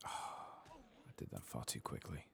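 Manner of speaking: ordinary talk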